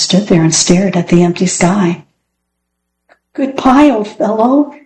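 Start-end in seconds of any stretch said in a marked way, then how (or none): 3.3-4.7 higher, calling farewell with sorrow; called out sadly. A narrator's reading. Alan bidding farewell to Fritz flown away, sad call. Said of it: "he called out sadly".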